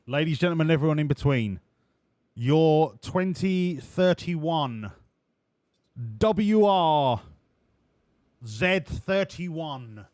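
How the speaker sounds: loud, drawn-out showman announcer; grand reveal announcement. Acted. Streamer unveiling the new car to chat, as a loud showman. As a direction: unveil it like a ringmaster.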